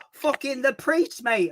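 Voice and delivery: high, slow Hull delivery; Hull accent character. Acted. Character riffing to his mate, high Hull accent. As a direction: stretch it out as a put-on Hull lad. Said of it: "I can't do that accent".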